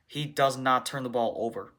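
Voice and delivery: ordinary talk, nothing marked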